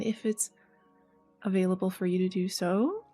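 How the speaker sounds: plain reading voice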